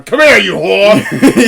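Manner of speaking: loud, high, drawn-out Bond parody shout; mock female Bond. Female Bond grabbing dudes by the arm, mock shout. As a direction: holler it slowly as a hammed-up Bond. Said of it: "she'll just be c-, grabbing dudes".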